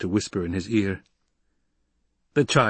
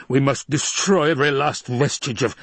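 raised, urgent rasp; agitated villain's rasp, higher; rasping Baron; 6 semitones higher, 4 dB louder than usual. Acted. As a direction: rasp it out as a forceful demand. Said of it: "the Baron rasped".

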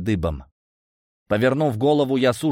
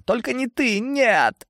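high-pitched panicked plea; terrified; slower than usual. A narrator's reading. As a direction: beg in terror as the rescuer approaches. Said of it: "испугался волшебник". (в ужасе, высоким голосом)

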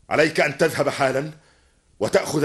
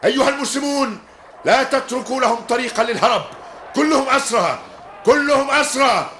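shouted commands; 11 semitones higher than usual. Acted. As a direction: bark orders over the fight. Commander shouting orders in battle.

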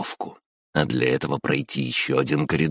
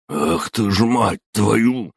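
slow furious growl; 7 semitones higher, 4 dB louder, slower than usual. Acted. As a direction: snarl the curse out. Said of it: "зарычал он".

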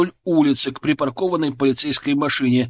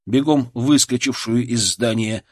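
usual speaking voice

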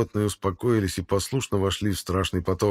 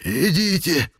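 pleading hiss; 9 semitones higher, 3 dB louder, slower than usual. Acted. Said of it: "хрип перешёл в умоляющее шипение".

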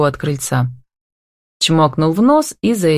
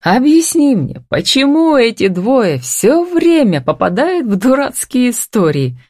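high, slow, amused exasperation; roaring with laughter; 8 semitones higher, 3 dB louder than usual. A narrator's reading. Mark asks Tanya about the hapless pair, laughing. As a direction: draw it out high, as if tickled by the absurdity. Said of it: "расхохотался он".

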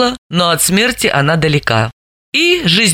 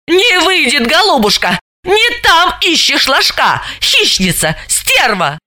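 taunting; 9 semitones higher than usual. Acted. Continued insults at rival.

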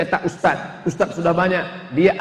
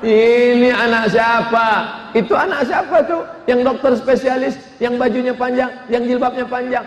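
high, proud boasting mother; high bragging mother voice, laughing; 6 semitones higher than usual. Acted. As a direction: brag high about her child.

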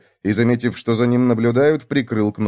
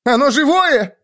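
high-pitched startled cry; horrified; 14 semitones higher, 4 dB louder than usual. A narrator's reading. Hare startled that the dummy lives, horrified. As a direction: jump in fright, voice shooting up. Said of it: "ужаснулся заяц".

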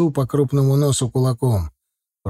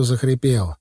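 ordinary delivery, nothing marked

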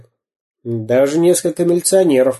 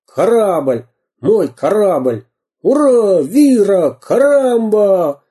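громкие протяжные выкрики, выше; выкрикивай, как бравый пират; пиратские выкрики; 8 semitones higher, 4 dB louder, much slower than usual. Acted. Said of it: "выкрикивал пират непонятные слова". (пират на корабле громко выкрикивает команды)

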